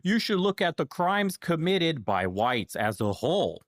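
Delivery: high mock voice of an arguer; mocking objector. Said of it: "the argument comes up".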